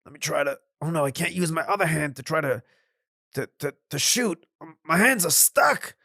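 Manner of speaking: panicked landowner. Acted. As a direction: discover you can't move and panic. Landowner finds himself frozen.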